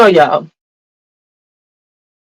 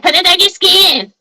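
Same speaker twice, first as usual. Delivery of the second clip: much higher mock voice of a friend egging her on; imitating Mark; 11 semitones higher than usual. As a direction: toss it off high, teasing like a pushy friend. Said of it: "Since Mark gonna be like".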